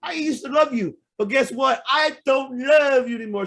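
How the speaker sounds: high-pitched hurt yelling; angry shout. Real, not acted. Fan rant turning on Bruce.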